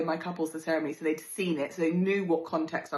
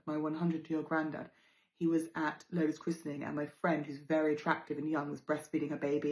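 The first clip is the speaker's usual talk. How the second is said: ordinary delivery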